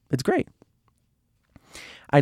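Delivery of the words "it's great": great lifted high into a bright rise; rising it's great. Shown as a demonstration. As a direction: repeat it as an excited question.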